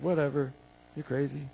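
imitating an unimpressed husband; dismissive husband. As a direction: brush her off quietly.

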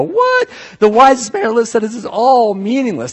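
high, loud shocked "what?"; overwhelmed disbelief. Acted. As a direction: react as a reader thrown by the claim. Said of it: "get really overwhelmed and go".

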